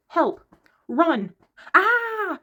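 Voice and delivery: loud, high playful yelps ending in a squeal; mock panicked cries. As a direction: act out a frog's panic signs. Frog's one-word signs voiced.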